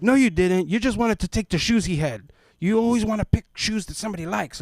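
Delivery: loud, high scolding mother; scolding mom. Retold or imitated. Mother loudly scolding her boy about the shoes. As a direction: snap it at the kid. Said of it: "the mom was like".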